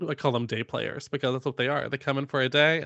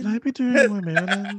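mocking Karen; 7 semitones higher than usual. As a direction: ham up the question. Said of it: "mocking Karen again".

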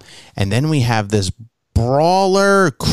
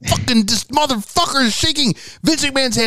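pitched way up, acted outrage; retold indignant protest; 12 semitones higher than usual. Son explaining the wrestling betrayal to dad, indignant. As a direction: protest like an outraged young fan.

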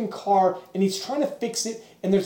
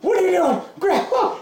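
high, laughing alarm at grandma; mock alarmed plea; 8 semitones higher than usual. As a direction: cry out as she starts the car. Pinned man alarmed.

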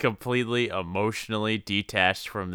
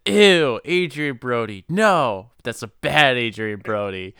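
mock scolding; 3 semitones higher, 6 dB louder than usual. Co-host scolding Adrian Brody for that look, mock disgust. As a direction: tell him off like a naughty dog. Scolding the actor over the scene.

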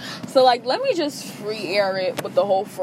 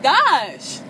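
higher, mock-excited nudge to hype up; hyped-up call; 5 semitones higher, much slower than usual. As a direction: cheer the tired friends on, half teasing. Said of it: "I'm just like".

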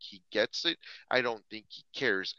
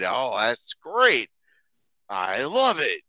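imitating Vince; 9 dB louder, slower than usual. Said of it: "he said".

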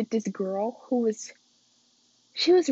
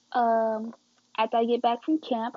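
ordinary talk

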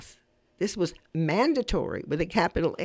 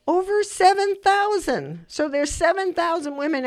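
proud excitement; 9 semitones higher, 5 dB louder than usual. Real, not acted. Host reveals how many pledge cards went out, proud excitement.

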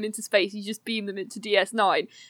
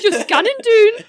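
louder, slow, hammed Geordie; Geordie accent; 10 semitones higher, 7 dB louder, much slower than usual. Geordie Odo corrects the order, slow Geordie. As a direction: stretch it out in broad Geordie. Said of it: "more from Geordie Odo".

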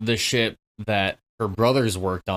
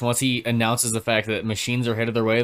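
normal talking voice

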